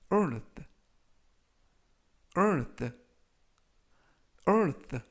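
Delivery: earth said slowly and clearly; model pronunciation. Shown as a demonstration. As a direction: pronounce earth with the th at the end. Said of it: "라고 발음하시면 되겠고요".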